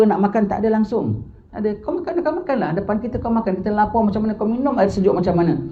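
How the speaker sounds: ordinary talk, nothing marked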